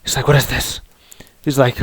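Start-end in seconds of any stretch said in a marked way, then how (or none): 0.3-0.8 Vince McMahon. Retold or imitated. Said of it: "Vince McMahon puppet comes".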